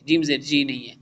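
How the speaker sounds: usual speaking voice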